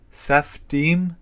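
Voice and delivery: "Cefdime" ending in a long-ee "deem"; odd pronunciation. Shown as a demonstration. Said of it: "will be pronounced as deem".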